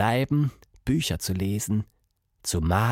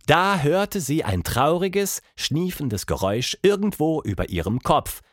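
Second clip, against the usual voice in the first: plain reading voice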